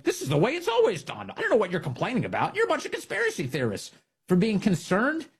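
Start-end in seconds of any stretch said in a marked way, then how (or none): 0.0-3.9 mocking a condescending pundit. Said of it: "the establishment Democrat Party saying".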